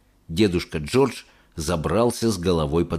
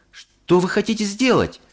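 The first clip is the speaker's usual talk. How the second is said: put-on boyish shout; 11 semitones higher than usual. A narrator's reading. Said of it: "закричал Чарли".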